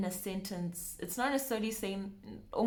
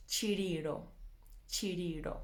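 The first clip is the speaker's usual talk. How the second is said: ordinary talk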